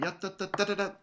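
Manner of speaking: rushed "ya da da da da da", like sixteenths; mock the student's too-fast triplet; imitating rushed triplet. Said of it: "You're playing".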